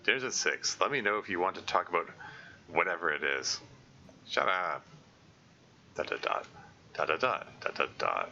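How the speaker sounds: ordinary talk, nothing marked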